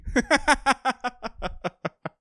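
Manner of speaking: helpless high-pitched giggling, drawn out; long high giggle. Real, not acted. Podcaster laughing at his own decisions, long giggle. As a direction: keep giggling at your own misery.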